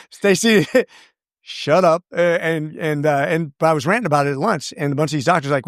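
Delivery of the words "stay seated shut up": laughing. Real, not acted. Laughing at host's joke.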